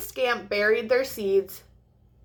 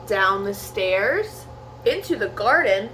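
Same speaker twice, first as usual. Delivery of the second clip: plain reading voice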